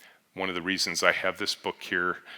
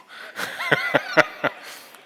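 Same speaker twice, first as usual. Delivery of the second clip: real laugh; 8 semitones higher, 7 dB louder than usual. Real, not acted. Audience laugh after punchline.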